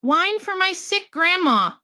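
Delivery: a lightly voiced innocent girl; little girl voice. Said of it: "Little Red said".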